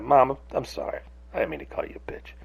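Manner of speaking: hushed imitation of saying sorry; retold apology. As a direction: apologize quietly to mom, fading almost to a whisper. Retold apology to his mom.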